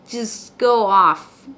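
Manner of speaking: ordinary talk, nothing marked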